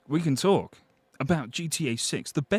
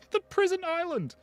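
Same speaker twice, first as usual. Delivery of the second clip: much higher squeal of mock alarm; squeaky mock exclaim; 14 semitones higher than usual. Streamer mockingly squealing a viewer's phrase. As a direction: squeal it comically.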